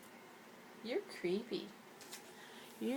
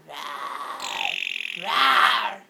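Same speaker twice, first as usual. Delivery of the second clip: mock beast roar, swelling; playful monster rawr; 11 semitones lower, 8 dB louder than usual. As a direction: pretend growl building to loud. Playful growling at pet bird.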